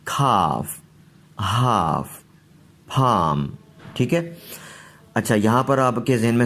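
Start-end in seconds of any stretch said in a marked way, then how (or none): 0.1-3.5 खाफ, हाफ, फाम with a long aa; model pronunciation. Shown as a demonstration. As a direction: read the list like a teacher. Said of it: "word drill". (साफ़ उच्चारण)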